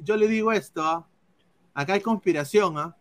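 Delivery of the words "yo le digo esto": Yo lo digo pitched high and loud; worked-up outburst. Real, not acted. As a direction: insist heatedly, voice up high. Heated claim of conspiracy.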